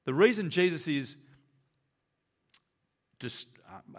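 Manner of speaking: normal talking voice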